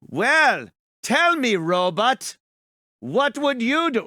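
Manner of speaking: imitating a high, chirpy 1930s host; lightly mock the showman questioning the robot; old-time announcer. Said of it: "They called 'em robots".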